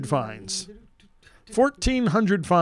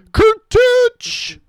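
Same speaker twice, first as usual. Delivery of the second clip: excited shout; 9 dB louder than usual. Acted. Calling out the next name.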